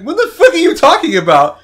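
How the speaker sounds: laughing disbelief. Retold or imitated. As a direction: laugh it out like you can't believe what you're hearing. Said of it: "I'm like".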